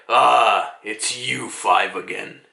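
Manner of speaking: low, slow giant voice; drawn-out low mock gravitas; imagined ancient giant. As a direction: imitate a weary giant recognizing old foes. Imagined giant recognizing the five elders.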